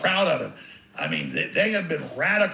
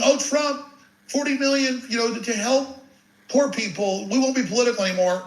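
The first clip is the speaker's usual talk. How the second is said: higher-pitched mock pleading of opponents; gloating mockery; 6 semitones higher than usual. Host imitating opponents who sit back laughing, mocking. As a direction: imitate smug opponents putting on a high, pitiful plea. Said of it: "they're just sitting back laughing".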